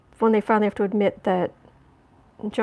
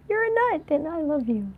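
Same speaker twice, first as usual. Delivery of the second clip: much higher, fond pet talk; delighted squeal to the cat; 6 semitones higher than usual. Real, not acted. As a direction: squeal with affection.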